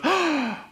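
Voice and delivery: mock gasp of inspiration; sharp, acted intake of breath; sudden gasp. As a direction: gasp as if an idea just hit. Plotter struck by an idea.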